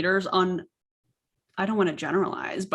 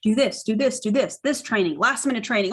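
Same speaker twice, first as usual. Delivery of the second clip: normal talking voice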